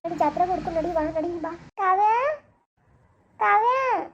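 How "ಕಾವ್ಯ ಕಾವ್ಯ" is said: loud call of the name across the crowd. Acted. Mother searching the fair, calling for her lost daughter. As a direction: call her name as if she's far away.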